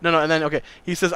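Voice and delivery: ordinary delivery, nothing marked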